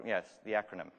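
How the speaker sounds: ordinary talk, nothing marked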